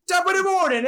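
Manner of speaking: high, hammed-up Irish accent; a cheesy fake Irish greeting; bad Irish accent. Co-host tries an Irish accent for Saint Patty's. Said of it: "That was a horrible accent".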